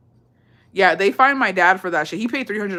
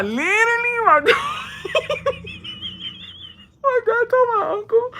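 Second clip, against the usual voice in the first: high laughing shout, words squeezed through. Real, not acted. Starting the sombrero story to friends, laughing hard.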